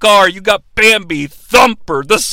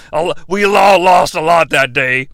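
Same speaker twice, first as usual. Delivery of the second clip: low, solemn mock-confession voice; mock-mournful low wrestler; Kane; 4 semitones lower than usual. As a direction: play it low and grave, hamming up the regret. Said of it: "have him be like".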